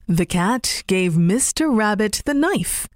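usual speaking voice

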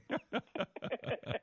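real high-pitched chuckle; a run of high, amused chuckles; laugh. Real, not acted. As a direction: laugh off a compliment. Host laughs off a big compliment.

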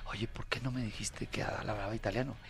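hushed, breathy aside; a secret whispered to a friend. Retold or imitated. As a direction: whisper it so nobody else hears. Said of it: "le dice eso a Lalo sotto voce".

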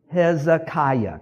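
"Hezekiah" slow and high, syllable by syllable; slow teaching pronunciation. Shown as a demonstration. Preacher has congregation say it along, "Hezekiah" in slow syllables. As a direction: spell out "Hezekiah" by sound for repeating. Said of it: "Say this word with me".

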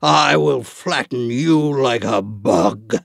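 slow, menacing giant; threatening giant voice. Acted. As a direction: growl out the threat. Goliath threatens David.

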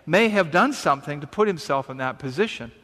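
ordinary talk

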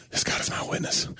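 hushed whisper of a stunned manager; whispered line. Retold or imitated. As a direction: breathe it out as a whisper. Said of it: "he says".

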